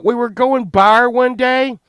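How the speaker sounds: ordinary talk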